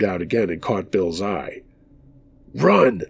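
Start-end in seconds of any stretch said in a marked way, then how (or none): 2.5-3.0 high, contained cry to run; narrated scream, pitch jumps up; urgent call without full volume; mild scream. A narrator's reading. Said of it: "I screamed".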